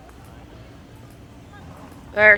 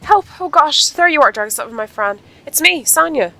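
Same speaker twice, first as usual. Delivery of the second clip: put-on lightly raised, cheerful young rider voice; Sonya voice; 9 semitones higher, 10 dB louder than usual. Sonya greeting her friend, lightly raised cheerful voice. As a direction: greet your friend brightly, pitched up a bit.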